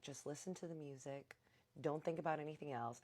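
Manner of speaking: very soft retold soothing voice; calm, soothing. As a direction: lull a panicking friend, hushed. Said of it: "listening to his calm voice".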